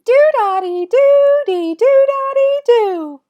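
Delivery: loud, squeaky, drawn-out rhythm chant; super high-pitched voice. Shown as a demonstration. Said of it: "with a super high-pitched voice".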